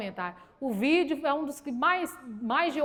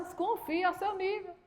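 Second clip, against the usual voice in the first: higher, soft mock-polite reproof; 4 semitones higher, 7 dB quieter than usual. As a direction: rebuke lightly in a raised, quiet voice. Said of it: "Como cristã, eu falo assim".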